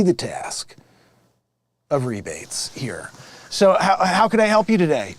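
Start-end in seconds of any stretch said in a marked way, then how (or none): 0.0-3.1 whispered aside. Acted. Confiding to victim on phone.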